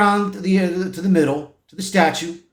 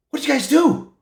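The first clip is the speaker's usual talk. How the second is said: high, incredulous reporters' question; shocked question; 7 semitones higher than usual. Retold or imitated. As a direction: ask in alarm, pitched well up. Alarmed reporters question the heroes.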